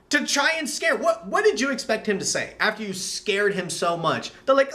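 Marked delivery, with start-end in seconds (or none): none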